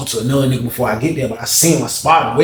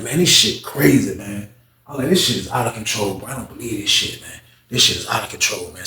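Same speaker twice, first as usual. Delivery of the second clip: low muttering; 4 semitones lower, 5 dB quieter than usual. Retold or imitated. Muttering in shock.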